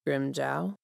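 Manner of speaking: soft, hushed call of the name; spoken softly. Acted. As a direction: gently test if he's awake. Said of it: "he spoke softly".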